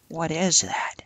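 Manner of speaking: hushed startled question; whisper. Real, not acted. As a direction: whisper it in surprise. Spots something, whispering.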